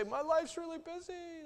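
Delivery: high, slow, whiny excuse; hammed-up busy person; mock excuse. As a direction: whine it like a busy churchgoer.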